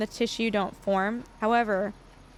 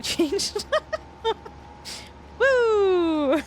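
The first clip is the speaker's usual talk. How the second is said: real rueful laughing; 8 semitones higher than usual. Real, not acted. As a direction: break into laughter, ending on a high whoop. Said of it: "I'm laughing 'cause it sucks".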